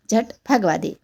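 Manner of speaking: ordinary delivery, nothing marked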